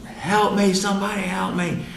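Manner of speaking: very high, strained scream held down in volume; mock scream. Patient calling with all the strength he can, restrained mock scream. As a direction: imitate screaming for help without real loudness.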